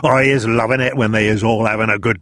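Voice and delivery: gleeful storybook giant; BFG character voice. Acted. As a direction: relish the fight as a simple giant.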